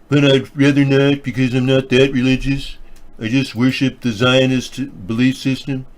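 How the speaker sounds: deadpan showman aside in character; mock Donald Jones persona. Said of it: "Donald Jones".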